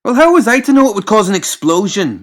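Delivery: high, loud, exasperated protest. Acted. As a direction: throw up your hands at the question. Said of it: "getting exasperated".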